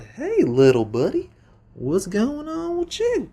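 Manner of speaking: high, drawn-out mock teacher voice; teacher calling to kid. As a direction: call out slowly and high to a little kid. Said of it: "so she goes over to check it out".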